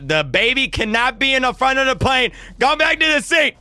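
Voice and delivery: imitating an insistent flight attendant, pitched high; exasperated order. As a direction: raise the pitch sharply as the order escalates. Attendant repeats order, escalating.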